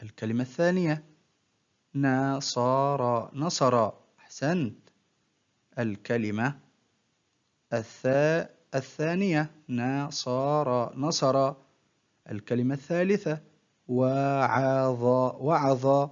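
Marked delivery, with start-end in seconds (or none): 2.0-3.4 very slow, each syllable drawn out separately; broken into syllables. Shown as a demonstration. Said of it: "تقطع الكلمة إلى حروف". 9.7-11.0 very slow, each syllable drawn out separately; broken into syllables. Shown as a demonstration. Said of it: "تقطع الكلمة إلى حروف". 13.9-15.0 very slow, each syllable drawn out separately; broken into syllables. Shown as a demonstration. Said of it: "تقطع الكلمة إلى حروف".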